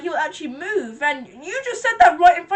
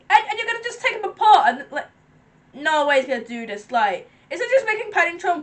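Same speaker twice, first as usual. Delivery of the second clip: normal talking voice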